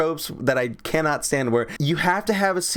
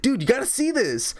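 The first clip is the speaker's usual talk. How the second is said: much higher excited mock voice; hammed-up eager friend; 11 semitones higher than usual. Friend telling Zac to come see, high and excited.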